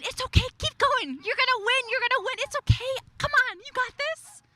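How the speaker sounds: high, tender coaxing voice; gentle encouragement. Pretend. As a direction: lift your voice and soothe, you got this. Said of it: "he's saying".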